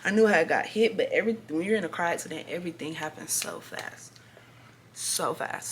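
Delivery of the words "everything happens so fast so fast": whispered, dropping to a hush. Retold or imitated. Explaining to viewers how fast a crash feels, whispered. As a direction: breathe the words like a secret. Describing speed of the accident.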